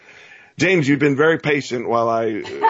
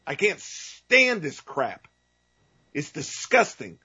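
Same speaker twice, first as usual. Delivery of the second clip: ordinary talk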